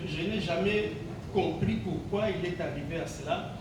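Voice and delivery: normal talking voice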